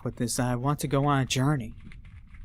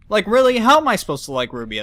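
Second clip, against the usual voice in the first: indignant; 6 semitones higher, 5 dB louder, faster than usual. Real, not acted. Indignant at Ruby.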